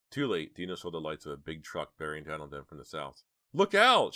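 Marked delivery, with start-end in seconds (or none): 3.5-4.1 very high, loud yell; alarmed high-pitched shout; shouted warning. A narrator's reading. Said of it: "she shouted".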